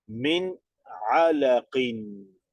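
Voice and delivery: "Min alaqin" read slowly with no nasal sound on the nun; slow tajweed reading of "alaqin"; Arabic recitation demo. Shown as a demonstration. Teacher modelling the word for the class, slow and unnasalized. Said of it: "Entonces no nasaliza".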